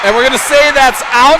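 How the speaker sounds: loud, high announcer's verdict; excited shouted call. Real, not acted. Announcer rules on the big hit, shouting excitedly. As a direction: call it out at full voice, pitched high.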